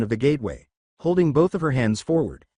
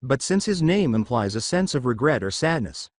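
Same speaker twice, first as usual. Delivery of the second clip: ordinary delivery, nothing marked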